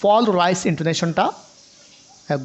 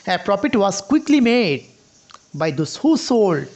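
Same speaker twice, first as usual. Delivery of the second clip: very slow, "quickly" weighted with a falling tune; slow model reading; much slower than usual. Shown as a demonstration. Teacher demonstrating the second meaning, slow and deliberate. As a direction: read it slowly and lean on "quickly". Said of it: "দ্বিতীয় নম্বর অর্থ".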